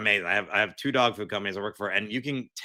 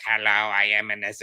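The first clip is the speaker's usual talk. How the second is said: lightly flat mock robot voice; a hint of an old-school robot. Guest showing how robots should sound, mild robot voice. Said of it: "want the robots to still sound like robots".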